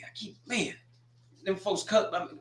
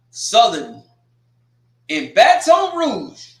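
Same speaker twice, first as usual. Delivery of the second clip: loud, high, slow drawn-out call; announcer call; 6 semitones higher, 9 dB louder, much slower than usual. Real, not acted. Announcing Southern's home game, a loud slow call. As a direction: stretch it like a game-day announcer.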